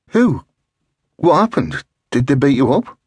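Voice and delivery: high, urgent alarmed questions. Acted. Alarmed questions after seeing vomit.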